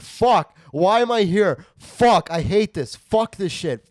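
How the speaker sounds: loud high screamed cursing; furious ranting. Retold or imitated. Sick comic losing it in the snow, screaming curses. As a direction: blow up, screaming at everything. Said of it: "And I'm like".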